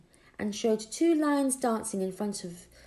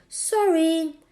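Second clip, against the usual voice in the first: apologetic call; 8 semitones higher, 7 dB louder than usual. Acted. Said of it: "Trying to say".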